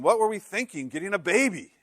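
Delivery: mock exasperation. Exhausted parents regret at 3 a.m.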